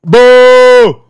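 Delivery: loud jeering boos from the crowd; heckling himself, loud and jeering; mock heckler booing. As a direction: boo him off like an angry crowd. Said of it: "he's preaching".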